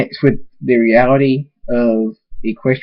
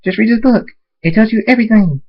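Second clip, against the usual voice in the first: high-pitched, eager chatter; excited pony character; 8 semitones higher than usual. Acted. Pony urging a friend to bake cupcakes, excited. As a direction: chirp it brightly, pitched well up.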